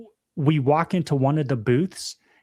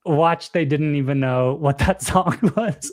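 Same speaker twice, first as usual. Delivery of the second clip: laughing quote. Retold or imitated. Retelling dad's joke about the song, laughing. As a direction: break into laughter mid-line.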